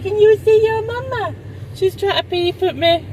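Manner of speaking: put-on high, far sing-song baby voice; baby talk for the little one; voicing the baby. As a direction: speak for the baby, high and cooing.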